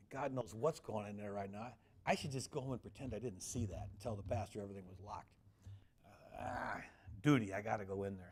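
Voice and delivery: ordinary talk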